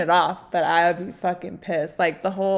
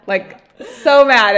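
even louder, slow, punching so mad; louder repeat; 4 semitones higher, 13 dB louder, slower than usual. Real, not acted. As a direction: hammer it home louder. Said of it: "I get so mad".

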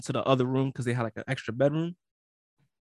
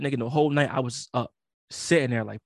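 usual speaking voice

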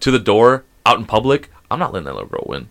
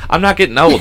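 firm, raised-pitch protest; insistent denial; 6 semitones higher, 4 dB louder than usual. Real, not acted. Host refusing to accept getting old, insistent denial. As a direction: deny it flatly to the teaser. Denying age to teasing partner.

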